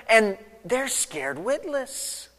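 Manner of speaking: ordinary talk, nothing marked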